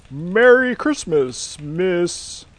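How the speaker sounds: jolly Santa voice. Acted. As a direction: greet her like Father Christmas himself. Santa greets a job candidate.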